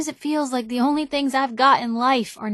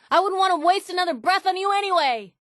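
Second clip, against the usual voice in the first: bitter; 8 semitones higher than usual. Acted. Bitter dismissal after argument.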